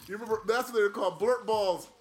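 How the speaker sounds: laughing through the words, fast and loud; can't stop giggling while saying it; giddy, laughing recall of a silly toy name. Real, not acted.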